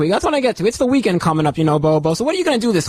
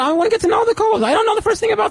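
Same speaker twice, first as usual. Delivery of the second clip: much higher, indignant and defensive; higher, offended host defending his questions; defensive whine; 12 semitones higher than usual. Real, not acted. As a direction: pitch up like you're offended. Defending himself against caller.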